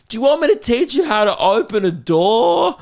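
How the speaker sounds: put-on high, loud kid's taunt; mock-helpful, high-pitched jeer; condescending mocking. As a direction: sneer it like a cocky twelve-year-old. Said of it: "most condescending shit to me".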